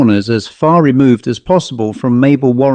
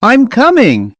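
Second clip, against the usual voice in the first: much higher called-out reply; despairing call; 10 semitones higher than usual. A narrator's reading. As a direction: call back, full of despair. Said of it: "he called with despair".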